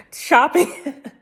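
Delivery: laughing. Real, not acted. Laughs mid-word.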